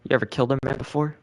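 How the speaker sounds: mock CIA recruiter grilling a new hire, dropping to a chilling hush; menacing recruiter.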